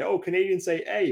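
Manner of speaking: high-pitched mimicry of outsiders; mocking imitation. Quoting what Americans say, mocking imitation.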